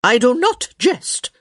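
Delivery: put-on high, loud, imperious old lady; sharp tenor. A narrator's reading. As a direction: protest sharply as an offended dowager. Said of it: "her voice returning to its usual sharp tenor".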